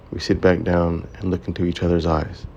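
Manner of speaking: plain reading voice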